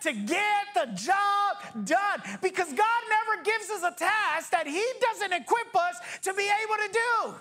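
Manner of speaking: full-force sermon delivery; preaching. Acted.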